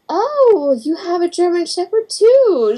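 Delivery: high-pitched imitation of a friendly dog owner; sing-song mock polite lady; gushing lady. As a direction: coo it high, drawing out the "oh". Voicing the lady at dog school.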